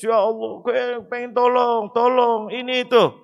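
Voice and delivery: imitated wailing cry. People in hell begging God for help, imitated high wail. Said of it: "Ketika di neraka teriak semua orang, nangis".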